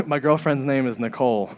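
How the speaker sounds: usual speaking voice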